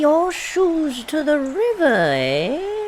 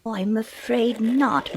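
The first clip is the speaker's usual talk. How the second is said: ordinary delivery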